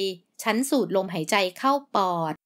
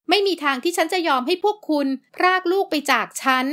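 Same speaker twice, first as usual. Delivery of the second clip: higher, louder, fierce defiance; furious refusal; 8 semitones higher, 4 dB louder than usual. Acted. Mother shouts that nobody will take her child, furious. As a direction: fling the refusal at them in fury.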